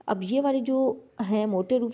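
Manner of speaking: usual speaking voice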